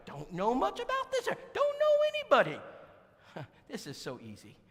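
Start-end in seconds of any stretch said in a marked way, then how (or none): 0.1-2.6 high, rising lady voice getting louder; Brenda's voice. Retold or imitated. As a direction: climb up high and push it like Brenda.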